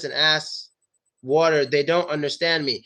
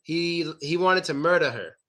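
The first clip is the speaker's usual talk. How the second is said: usual speaking voice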